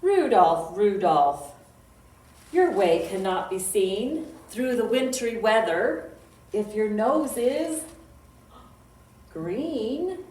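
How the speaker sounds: loud, sing-song poem reading; children's poem recital. Acted. Librarian reciting a reindeer poem to kids, loud sing-song. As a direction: chant the rhyme out for little listeners.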